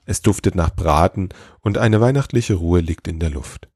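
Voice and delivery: usual speaking voice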